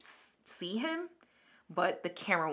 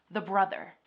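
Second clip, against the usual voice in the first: ordinary delivery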